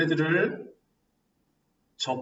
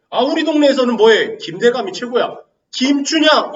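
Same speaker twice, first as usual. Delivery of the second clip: loud local boast; 5 semitones higher, 6 dB louder than usual. Acted. Acting locals boasting of their lord.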